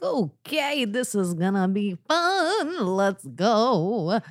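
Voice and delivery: sarcastic. Retold or imitated. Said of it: "And I was like".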